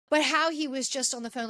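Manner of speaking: ordinary delivery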